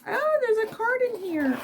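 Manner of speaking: surprised. Real, not acted. Finding a card in the box.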